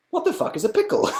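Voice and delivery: a very high, squeaky bewildered question; baffled self-mockery. Mocking his own confusion about pickles, high and baffled. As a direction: play your past self as utterly clueless. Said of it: "I don't know why I was like".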